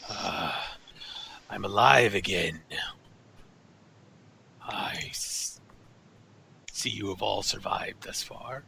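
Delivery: low, slow rasping monster voice; deep gravelly voice. Acted. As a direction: growl it out like an ancient beast. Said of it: "in a, a deep, gravelly voice".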